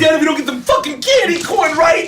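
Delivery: yelling in a panic; panicked shout. Pretend. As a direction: shout it, desperate. Werewolf panic at full moon.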